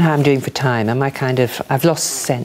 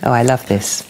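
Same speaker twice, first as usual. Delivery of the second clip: ordinary talk, nothing marked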